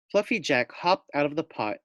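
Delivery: ordinary delivery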